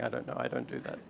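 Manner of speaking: ordinary delivery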